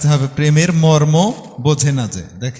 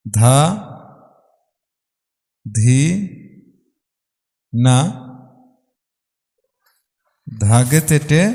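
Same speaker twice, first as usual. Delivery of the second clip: spaced, rhythmic recitation of the bols; reciting tabla bol; much slower than usual. Shown as a demonstration. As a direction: recite the bols one beat at a time. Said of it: "বোলে কি দাঁড়াবে".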